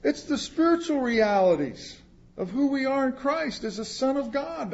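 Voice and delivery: ordinary delivery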